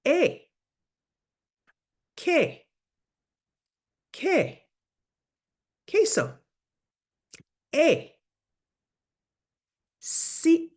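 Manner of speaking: teacher leading a repeat-after-me drill; pronunciation drill. Shown as a demonstration. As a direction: call out "que", "queso" with a hard K sound for students to repeat. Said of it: "Repeat after me".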